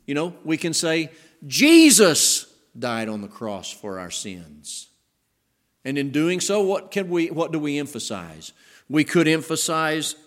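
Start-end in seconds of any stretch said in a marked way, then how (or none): 1.5-4.9 "Jesus" loud and drawn out, then drop away; slow line led by a big, held "Jesus"; stress on Jesus. Shown as a demonstration. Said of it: "changing the emphasis on the words".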